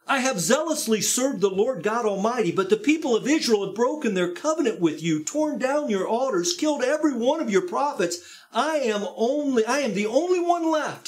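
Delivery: anguished Elijah pleading his case; Elijah's anguished complaint. Acted. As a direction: pour out Elijah's despair. Elijah repeats complaint, higher.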